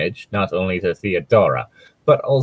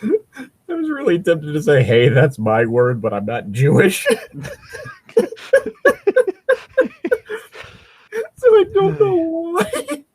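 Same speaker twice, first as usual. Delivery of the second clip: laughing through the words; high, giggling speech; 3 dB louder than usual. Real, not acted. Laughing while speaking.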